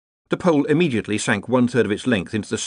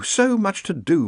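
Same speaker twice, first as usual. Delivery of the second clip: higher, slower repeat; fretful muttering; 11 semitones higher than usual. A narrator's reading. Ponder fretting over paperwork, slow raised mutter. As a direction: draw it out slowly, pitched up. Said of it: "muttered Ponder".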